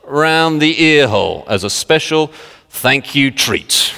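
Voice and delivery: slow, jokey Yorkshire imitation; Yorkshire accent. Old-timer recalling mum's clip round the ear, Yorkshire accent. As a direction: relish each word in mock Yorkshire. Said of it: "and it's a Yorkshire accent".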